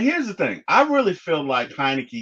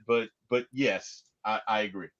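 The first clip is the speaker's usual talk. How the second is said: ordinary talk, nothing marked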